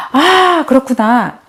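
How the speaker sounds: high 'aha' from a pupil, then settling; imitated realization. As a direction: mimic a child suddenly getting it. Child takes in teacher's explanation. (아이가 깨달은 듯 높은 감탄조)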